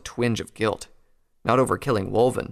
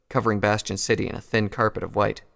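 plain reading voice

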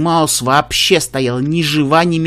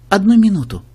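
gentle, higher princess voice; cartoon princess; 5 semitones higher, 3 dB louder than usual. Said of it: "мягкий голос принцессы".

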